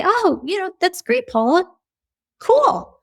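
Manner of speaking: high, lightly voiced lukewarm oh cool; mock passive response. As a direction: hand back a half-hearted cool. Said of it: "passive constructive".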